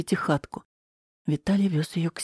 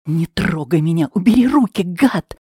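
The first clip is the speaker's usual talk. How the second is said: крик; 7 dB louder than usual. Acted. Героиня отбивается от насильника.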